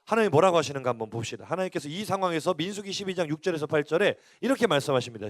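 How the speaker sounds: usual speaking voice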